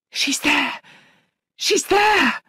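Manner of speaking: high, loud cry, climbing on the repeat; frantic cry. A narrator's reading. Governess shaking Missus Grose's arm as she sees the ghost, frantic cry. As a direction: raise the alarm at the top of the voice.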